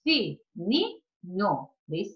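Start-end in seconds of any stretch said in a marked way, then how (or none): 0.0-1.0 FININHO split into fi, ni, nho; slow, separate beats on Fininho; syllable by syllable. Shown as a demonstration. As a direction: count out three syllables of Fininho with gaps. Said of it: "Vamos ver se ela é trissílaba?".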